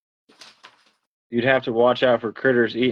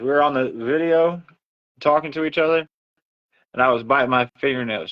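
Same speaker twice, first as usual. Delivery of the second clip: normal talking voice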